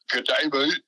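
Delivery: squeaky high Aussie outburst; cartoonish Australian greeting; Australian mode. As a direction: jump way up into a mock Aussie voice. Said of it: "he goes into the Australian mode".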